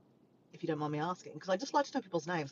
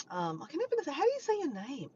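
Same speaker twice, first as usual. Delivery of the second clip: ordinary talk, nothing marked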